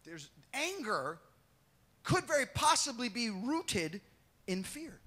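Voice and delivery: ordinary delivery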